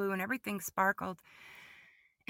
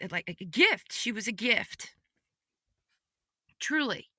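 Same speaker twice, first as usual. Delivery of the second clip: ordinary talk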